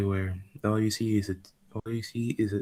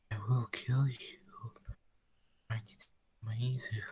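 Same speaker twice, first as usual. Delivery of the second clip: threatening whisper; 7 dB quieter than usual. Acted. Rose threatens Muzan.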